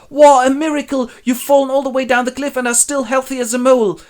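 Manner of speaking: high, loud, put-on yokel marvelling; amazed fisherman. Edgar as a fisherman marvels at the fall, high put-on voice. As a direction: gush over the miracle in a funny voice.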